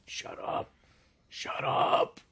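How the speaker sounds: harsh whisper building in force; whispering to himself. Acted. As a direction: whisper it to yourself, pushing harder the second time. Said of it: "beginning to whisper to himself".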